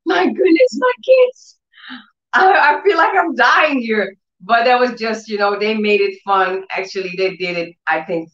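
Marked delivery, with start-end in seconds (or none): 0.0-4.2 hammed-up exasperation. Mother pleading with her filming kids, high hammed-up wail. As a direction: overplay the suffering mother. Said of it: "I was like".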